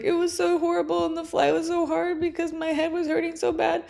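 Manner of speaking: high, wobbling voice between crying and laughing; tearful laugh-cry. Retold or imitated. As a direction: cry and giggle at once. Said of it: "because I was in tears".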